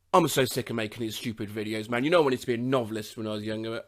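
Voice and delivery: low, soft, fed-up grumble; weary grumbling. Acted. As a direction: grumble privately, low and under your breath. Grumbling, believing himself muted.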